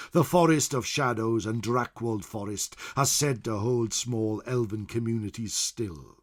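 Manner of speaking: usual speaking voice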